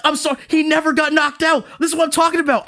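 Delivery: venting at the whole fandom, shouting; spazzing. Real, not acted. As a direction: blow up in frustration. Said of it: "I'm spazzing".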